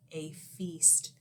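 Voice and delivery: unvoiced ominous whisper. A narrator's reading. Narrator hinting the lion might eat the mouse, whispered. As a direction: breathe it like a scary secret. Ominous hint of being eaten.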